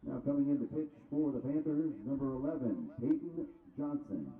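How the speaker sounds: booming PA voice over the ballpark; low, loud announcer call, quick then steady; stadium announcer. Real, not acted. PA announces the pitching change.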